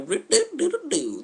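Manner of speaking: high, slow sing-song doo-doos; playful high nonsense syllables; carefree doodling noise. Retold or imitated. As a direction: warble a little wandering tune. The pair wandering around carefree.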